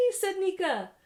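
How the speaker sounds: lively read-aloud storytelling voice; story narration. A narrator's reading. Parent reading a picture book to a child, animated narration.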